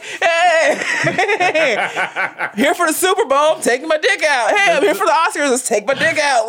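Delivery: mock cheerful greeting. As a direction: ham up the flasher greeting while cracking up.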